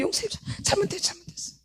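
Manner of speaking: high, soft whimpering plea fading to a whisper; pleading like a small child to mom; childlike pleading. Pretend. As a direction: beg sweetly like a scolded child. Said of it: "나한테 한 번 했는데".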